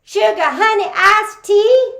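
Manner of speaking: high, loud indignant Texas auntie; mad as a wet hen. Acted. Aunt Hazel scolding Vernon at the funeral, high and indignant. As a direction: huff it out like an outraged aunt. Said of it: "she got mad as a wet hen".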